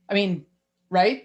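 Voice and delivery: ordinary delivery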